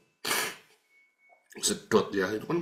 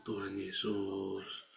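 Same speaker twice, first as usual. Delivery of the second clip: slow, much softer murmured calling on Jesus; hushed prayer; 4 semitones lower, 9 dB quieter, much slower than usual. Retold or imitated. Sitting alone at four in the morning, praying: slow hushed murmur. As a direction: breathe the name out slowly, barely voiced, like an early morning prayer. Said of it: "saya cuma duduk".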